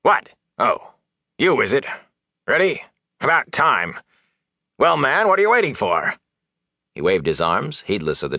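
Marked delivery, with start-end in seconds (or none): 0.0-6.2 starts startled, climbs higher and sharper; jolted, then impatient and scolding; irritated, rising to a snap. Acted.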